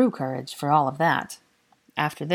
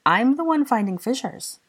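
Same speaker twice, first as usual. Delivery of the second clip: high, louder retort; upset protest; 8 semitones higher, 5 dB louder than usual. A narrator's reading. Granger turns Draco's accusation back on him, upset protest. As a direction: protest it, pitched well up.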